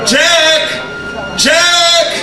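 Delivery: high calling out for lost love; Rose calling Jack. Pretend. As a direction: call his name high and desperate. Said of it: "calling out for your long-lost love".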